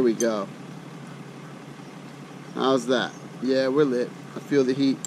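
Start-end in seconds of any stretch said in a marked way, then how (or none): none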